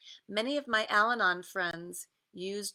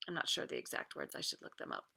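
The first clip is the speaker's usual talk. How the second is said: whispered, fast, to herself; whispered aside; 8 dB quieter, much faster than usual. Real, not acted. Aside while reading.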